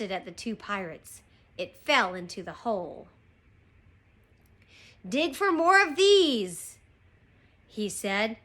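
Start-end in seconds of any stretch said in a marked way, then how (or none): 5.1-6.8 loud, raised-pitch captain's command; forceful storybook pirate order; shouted order. Acted. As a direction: call it out to the digging crew. Captain yells order to crew.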